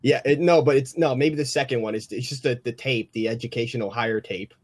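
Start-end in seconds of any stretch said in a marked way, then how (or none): none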